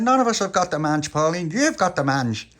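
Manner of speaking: high, loud indignant protest; indignant denial. Acted. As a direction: shout it down, offended. Tony protests to Pauline.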